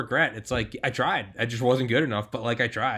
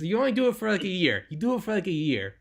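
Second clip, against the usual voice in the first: mock Israeli accent; 9 semitones higher than usual. Host imitating his Israeli guest on contracting pay, mock Israeli accent. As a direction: play the Israeli ex-soldier with a knowingly bad Israeli accent. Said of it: "I can't do an Israeli accent".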